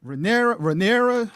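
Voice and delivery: "Rhaenyra" tried as ruh-NAIR-uh, high and loud; trying the pronunciation. Shown as a demonstration. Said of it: "still can't pronounce it right".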